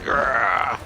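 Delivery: loud angry growl. Acted. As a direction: growl like a beast. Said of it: "I'm like".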